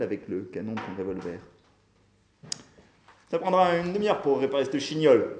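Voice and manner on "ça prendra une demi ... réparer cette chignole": loud, raised shout; calling out. A narrator's reading. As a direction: holler it over to the others. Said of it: "cria Hiram".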